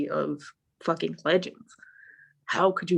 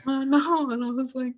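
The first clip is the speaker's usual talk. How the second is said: normal talking voice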